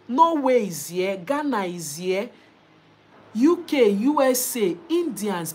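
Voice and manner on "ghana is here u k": ordinary delivery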